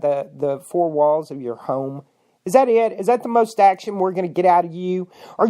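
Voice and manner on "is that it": ordinary talk, nothing marked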